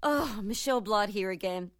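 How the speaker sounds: normal talking voice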